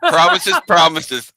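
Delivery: loud, high-pitched jeer; teasing retort. Real, not acted. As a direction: toss it out high and loud over laughter. Teasing retort amid laughter.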